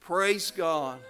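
high, very loud revival preaching at its climax; full-force preaching. Real, not acted. Preacher urging brothers and sisters to thank God, full-force preaching. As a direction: belt it out like a revival preacher, building to a peak.

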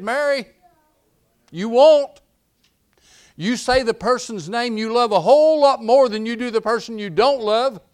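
loud, high, driving sermon voice; fiery pulpit preaching; preaching at full force. Acted. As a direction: preach it out to the whole church.

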